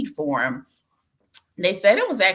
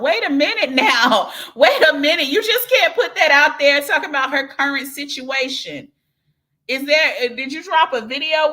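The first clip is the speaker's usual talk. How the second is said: higher and louder, laughing, nosy; catching a juicy hint and pouncing on it; playful teasing; 6 semitones higher, 6 dB louder than usual. Real, not acted. Teasing about her "situation.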